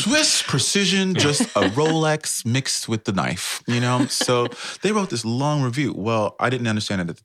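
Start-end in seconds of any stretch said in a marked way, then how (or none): 0.0-4.1 higher, louder, slow emphatic joke; excited emphasis. Real, not acted. Joking with the cohost about the Swiss review, excited. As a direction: lean into the punchline with glee.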